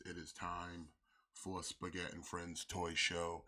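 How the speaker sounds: ordinary delivery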